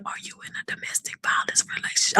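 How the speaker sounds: secretive whispered question; a stranger whispering a worried question to a mother; imitating a whisper. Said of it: "I don't know why I'm whispering like this".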